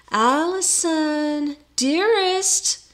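high, drawn-out wooing call; high cooing suitor. A narrator's reading. Absalom back at the window wooing Allison, high coo. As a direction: sing out the endearment high and slow.

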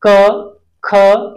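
teacher sounding out क, ख; clear syllable demo. Shown as a demonstration. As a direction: pronounce क and ख as model sounds for pupils. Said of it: "जैसे".

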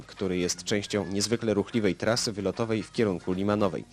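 ordinary talk, nothing marked

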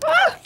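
sudden shrill scream; high scream. Acted. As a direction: cry out sharply, very high. Scream in the scuffle.

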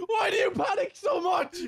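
high, exasperated shouting. Real, not acted. Heated banter over crash.

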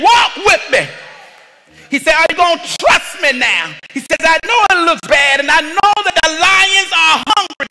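fervent sermon climax; preaching at full force. Acted. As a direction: drive it home from the pulpit.

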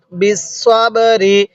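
বিসসাবরি slowly, with the সৎ sound clearly articulated; careful recitation. Shown as a demonstration. Teacher demonstrating the correct সৎ sound, slow careful recitation. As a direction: model বিসসাবরি slowly for students. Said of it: "এটা সৎ".

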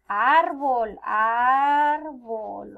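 árbol with a loud, stretched ár; loud, drawn-out árbol; stress on first A. Shown as a demonstration. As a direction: lean hard on the Á of árbol. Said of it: "la pronuncias más fuerte cuando dices".

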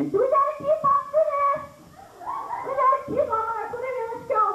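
a mom over the moon about a coupon; excited mom. Retold or imitated. Mom rushing the family through checkout, high excited shout.

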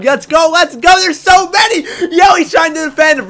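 yelling high and loud in glee; excited shout. Real, not acted. Streamer cheering his bee swarm, shouting in excitement. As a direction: cheer the bees on at full volume. Bees swarm and kill a player.

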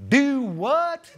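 high, loud "Do what?" in disbelief; baffled county official. Retold or imitated. Building department head to the caller, loudly baffled. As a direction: sound like he's never heard of it.

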